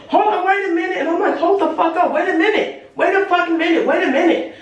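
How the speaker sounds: high, laughing hold on, wait a minute; panicked but laughing, voice up high; alarmed, laughing. Retold or imitated. Telling the jumping crowd to wait, high and laughing. Floor about to cave in.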